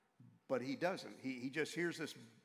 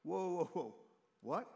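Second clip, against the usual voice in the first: higher-pitched mock disbelief; alarmed Moses; 5 semitones higher than usual. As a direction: balk as if handed an impossible job. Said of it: "He's like".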